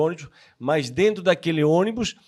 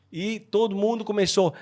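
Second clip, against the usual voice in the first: ordinary delivery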